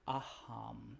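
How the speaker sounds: the word Aham said quietly and low, as if to yourself; softly spoken mantra. Shown as a demonstration. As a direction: murmur Aham inward, letting it sit. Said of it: "think or say to yourself".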